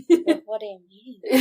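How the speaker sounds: lightly imitating someone flustered; mock flustered. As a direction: act a little thrown by the question. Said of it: "she gets all flustered".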